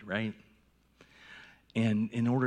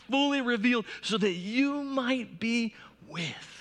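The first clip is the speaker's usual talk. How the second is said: preaching at full force; 9 semitones higher than usual. Acted. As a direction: build to a fervent climax. Preaching builds.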